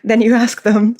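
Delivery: real, apologetic giggle in the voice; giggling. Real, not acted. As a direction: try to go on while giggling. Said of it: "sorry, I'm giggling".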